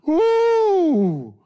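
high, joyful preacher's whoop; excited whoop. Real, not acted. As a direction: let out a happy shout. Exclaims after the joke.

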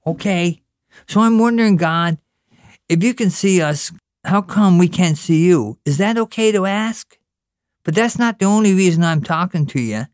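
put-on high, earnest little boy talking to God; a child pleading, all wide-eyed; boy at his first real prayer; young boy Pat praying. Pat's prayer continues.

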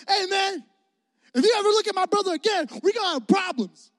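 high, fast hammed-up threat; big brother threat. Big brother warning Travis to never look at him, mock menace. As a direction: lay down the law to the bully.

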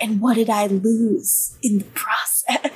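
a light brooding heartthrob voice, slowed; lightly voiced sulky teen idol, dragged out; Luke Perry voice. Imitating a moody TV heartthrob for a cohost, slowed and brooding. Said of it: "like Luke Perry in your impression".

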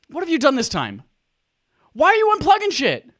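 half-joking scolding of the co-host; mock exasperation. Real, not acted. Scolding co-host over tech trouble.

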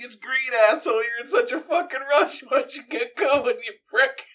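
high, laughing taunt at a raging driver; mocking taunt. Narrator taunting the honking driver at the light, laughing mockery. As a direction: rub it in with a giggle. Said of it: "I was like".

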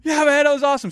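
high, loud, elated answer; match the thrill right back; excited reply. Retold or imitated. Art answering his tandem partner, high and loud with excitement. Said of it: "And he goes".

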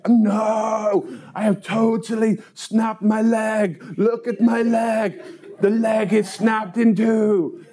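high-pitched mock exasperation; desperate protest. Paperboy insisting to woman leg is broken, desperate.

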